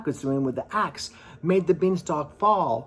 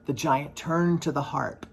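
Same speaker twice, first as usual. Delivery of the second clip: ordinary delivery, nothing marked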